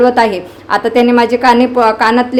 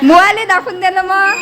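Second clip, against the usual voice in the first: teasing call; 8 semitones higher than usual. Real, not acted. Teasing call to the old man.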